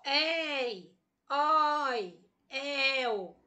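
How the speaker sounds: "ei, oi, el" stretched with wide-open vowels; open diphthongs. Shown as a demonstration. Teacher recapping open diphthongs, "ei, oi, el" long with open vowels. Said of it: "ditongos abertos".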